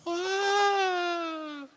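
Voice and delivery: high mock coaster scream; imitated scream. Pastor imitating roller coaster riders, mock scream. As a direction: let out a thrill-ride shriek.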